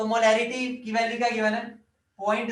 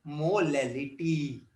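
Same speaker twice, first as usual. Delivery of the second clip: Molality very slow and lower; drawn-out answer; 6 semitones lower, 4 dB quieter, much slower than usual. Real, not acted. Teacher answering what we call it, slow and low. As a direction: stretch molality out, low and falling at the end.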